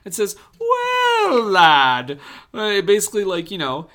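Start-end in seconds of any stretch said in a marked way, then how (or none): 0.6-2.2 high, loud hammy Scottish brogue; Scottish accent. Retold or imitated. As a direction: bellow "Well, lad" in a Scottish accent. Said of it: "Why do I sound like a leprechaun?".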